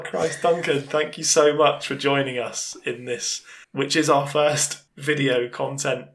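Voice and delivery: ordinary talk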